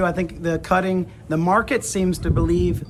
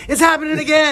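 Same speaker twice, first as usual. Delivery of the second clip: high, loud hammed-up alarm; mock panicked shout; 9 semitones higher, 4 dB louder than usual. Friend teasing Larry waking in bed, mock panicked shout. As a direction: yell it like the quake is back. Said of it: "you gonna be like".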